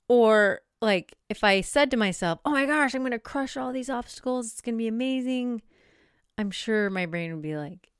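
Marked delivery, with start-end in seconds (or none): 2.4-5.6 mock hype. Mock overconfident self-talk.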